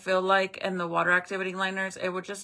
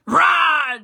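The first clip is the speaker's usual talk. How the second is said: sudden loud roar to scare; startling boo; 8 semitones higher, 13 dB louder than usual. Pretend. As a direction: jump out with a roar. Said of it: "Just playing".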